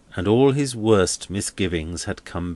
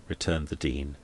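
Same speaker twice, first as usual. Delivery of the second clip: usual speaking voice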